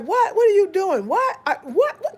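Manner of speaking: mock teenage outrage; outraged what. Teen boys hit by the bike, yelling in outrage.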